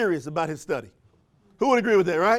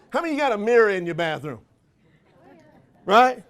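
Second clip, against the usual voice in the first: ordinary talk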